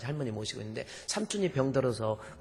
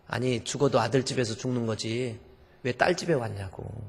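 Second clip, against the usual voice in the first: angry father-in-law; 8 dB louder than usual. Retold or imitated. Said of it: "막 화를 내면서".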